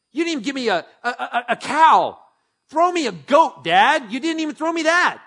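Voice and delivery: high, loud whining complaint; whiny outrage. Acted. Older brother whining at his dad about a goat, loud high whine. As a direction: throw the grievance at dad like a sulking kid.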